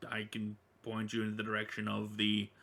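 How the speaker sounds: ordinary talk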